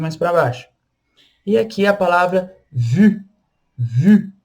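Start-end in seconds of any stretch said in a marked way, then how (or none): 2.7-4.3 "vu" with a tight, rounded French U; pouted French U. Shown as a demonstration. Teacher showing the pout nearer U, pronunciation demo. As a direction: pout towards U on vu. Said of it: "mais pro lado do".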